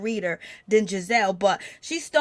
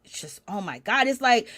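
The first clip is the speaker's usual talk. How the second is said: ordinary talk, nothing marked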